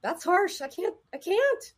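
high, squeamish imitation of someone balking; recoiling patient. Imitating a patient balking at harsh self-talk, recoiling. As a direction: wince and refuse, pitched well up. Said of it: "like my patient who was like".

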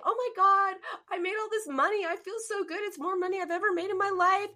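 high, gushing mock delight; imitated elation. Coach imitating client celebrating money, high and gushing. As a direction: gush high as if thrilled about money. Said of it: "So if you are saying".

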